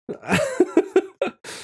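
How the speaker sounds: high, quick giggle; laugh. Real, not acted. Amused reaction.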